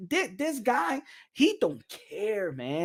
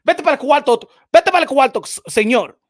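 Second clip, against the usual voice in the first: mock parent scolding; 7 semitones higher, 10 dB louder, faster than usual. Scolding a fatherless fighter.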